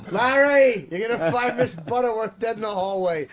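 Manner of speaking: high, loud call on LARRY; mock calling out. Host playing someone warning Larry about Miss Butterworth, mock hollering.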